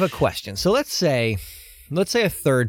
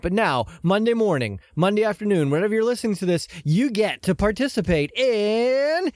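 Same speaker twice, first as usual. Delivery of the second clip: announcer build-up; 5 semitones higher than usual. Acted. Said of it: "announcing segment".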